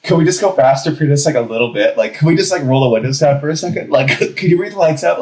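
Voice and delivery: hurried mock-desperate ask; frantic pleading. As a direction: rush it like a high person begging. Pleading in retold high panic.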